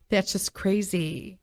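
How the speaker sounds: slow words through a laugh. Real, not acted. Laughing off tampons to the viewers, a slow laughing aside. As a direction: laugh it out in disbelief.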